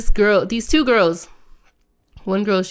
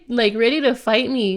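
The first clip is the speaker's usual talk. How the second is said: usual speaking voice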